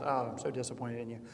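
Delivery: hint of a disappointed teacher; lightly sighing Beatrice, rushed. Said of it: "Beatrice heaves a sigh and says".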